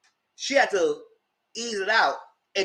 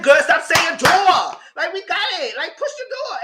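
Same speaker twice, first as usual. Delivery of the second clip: exasperated retort; 10 semitones higher, 6 dB louder than usual. Retold or imitated. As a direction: stretch it out fed up.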